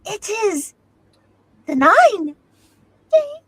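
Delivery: loud, drawn-out mock score announcement; hammed-up announcer. Co-host reading Henry's score from chat, loud silly announcing. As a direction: proclaim it in big separate chunks.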